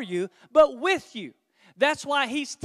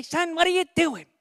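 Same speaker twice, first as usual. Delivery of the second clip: higher-pitched coach voice barking at player; imitating the coach; 5 semitones higher, 4 dB louder than usual. High school coach chewing out a player, higher mock bark. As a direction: play the old football coach scolding. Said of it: "He'd say".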